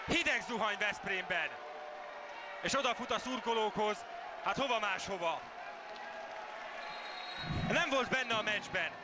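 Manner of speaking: loud, fast goal call; excited Hungarian sports commentary. Acted. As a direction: shout the goal like a live commentator.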